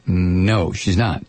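low, subdued reply to the officer; questioned passenger. Acted. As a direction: answer meekly in a low monotone.

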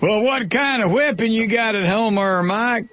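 high, nosy country voice; local Georgia caller. Retold or imitated. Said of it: "I have to do this occasionally".

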